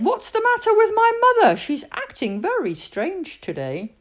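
high, puzzled girl voice, a hint of indignation; puzzled Julie. Julie asks why her mother acts strange, high and puzzled. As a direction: wonder out loud at mom. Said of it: "And Julie said".

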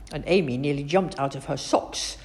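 plain reading voice

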